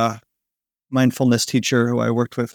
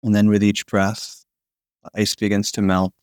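sleep story narrator; 2 semitones lower than usual. Acted. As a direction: lull them toward sleep, breath by breath. Guided body-melting sleep relaxation.

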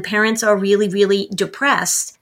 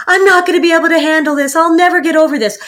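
higher, louder and fast, hammed-up distress; a parent voicing over-the-top grief to her child; mock overwhelmed despair; 7 semitones higher, 6 dB louder, much faster than usual. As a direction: spill it all out in a rush of mock despair. Mom overwhelming child with feelings.

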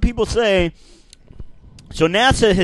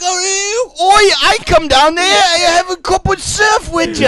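mock Australian; 10 semitones higher than usual. Friend answers the surfer in a bad Australian accent. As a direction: play a cartoon Aussie bloke. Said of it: "Brennan voice".

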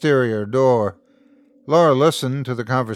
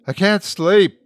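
high, loud distressed call; 9 semitones higher, 6 dB louder than usual. A narrator's reading. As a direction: cry out through the door. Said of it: "Geordie called out".

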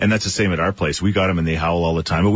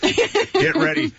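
drawn-out warning; much slower than usual. Acted. Warning listeners with drawn-out call.

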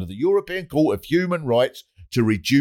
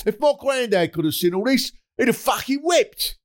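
mock window shout; 11 semitones higher than usual. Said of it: "lean out of the window and shout".

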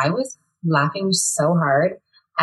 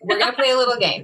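announcer voice; 5 semitones higher, faster than usual. Acted. Game announcement.

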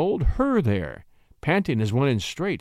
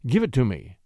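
ordinary delivery

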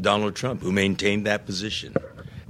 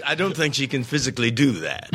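ordinary talk

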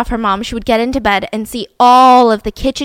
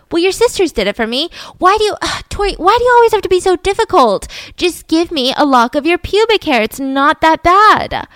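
higher, exasperated mom voice, rushed then slowing; nagging mother; 6 semitones higher, 3 dB louder than usual. Retold or imitated. Mother pressing her daughter for a lock of hair, exasperated. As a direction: play the pushy mother wheedling her kid.

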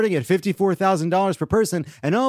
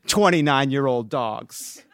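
ordinary talk

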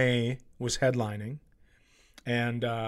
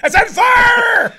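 very high, very loud slow yell; mock angry shout; 13 dB louder, slower than usual. Riffing on the plumber's line, a hammed-up furious yell. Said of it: "so mad when he yelled it".

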